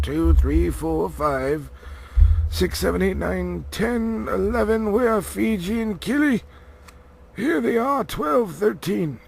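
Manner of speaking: high, loud wizard voice, brisk and fussy; Gandalf counting. A narrator's reading. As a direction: count them off like a harried wizard.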